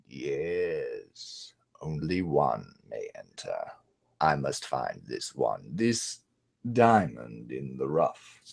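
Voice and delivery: hushed villain voice, low and slow; low menacing whisper. Retold or imitated. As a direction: whisper it like a scheming sorcerer. Jafar musing quietly.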